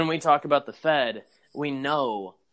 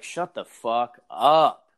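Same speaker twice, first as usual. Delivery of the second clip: very slow, louder, drawn-out shut-up; fed-up retort; 5 dB louder, much slower than usual. Retold or imitated. To bickering podcasters, a slow, loud fed-up retort. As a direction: drag each word out in disgust. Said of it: "sometimes it's like".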